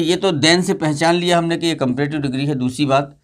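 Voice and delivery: ordinary talk, nothing marked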